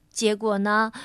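ordinary delivery

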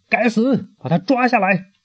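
queen yells at the guard captain; angry shout. Acted. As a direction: yell at the guard to seize him. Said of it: "对卫士长大喊".

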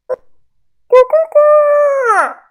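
loud, high, drawn-out cock-crow; rooster crow. Acted. Rooster waking the narrator at dawn, shrill loud crow. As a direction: crow long and piercing like a rooster. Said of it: "鸡叫声把我吵醒了".

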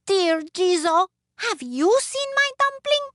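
very high, loud plea; high-pitched anxious little woman; little woman, pleading. Acted. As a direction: appeal to the statue in a piping voice. Little woman begs the statue.

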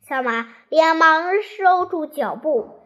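plain reading voice

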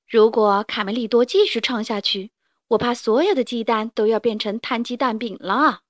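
ordinary delivery

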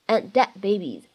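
"And dead babies" with both final d sounds held back; don't release the d of "And" or "dead" in "And dead babies"; loss of plosion. Shown as a demonstration. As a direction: run "And dead babies" together, d's swallowed. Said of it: "存在两处失去爆破的技巧".

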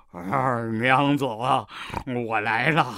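slow, low and tender. Acted. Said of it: "只听他温柔地说".

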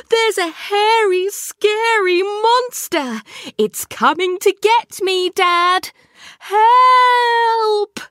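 high, loud, hammed-up cry for help; a boy faking terror to fool his dad; mock fright. A narrator's reading. Kipper tricking Dad into coming upstairs, hammed-up panic.

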